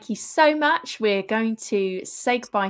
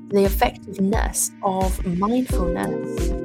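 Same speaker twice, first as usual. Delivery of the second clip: guided meditation. Acted. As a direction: ease the listener into relaxing. Guided meditation over music.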